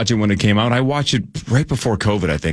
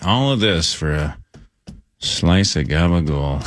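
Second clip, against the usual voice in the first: depressed; 4 semitones lower, slower than usual. Shown as a demonstration. A drive comes up short, slow depressed delivery. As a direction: drag it out like nothing came of it. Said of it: "make it sound depressed".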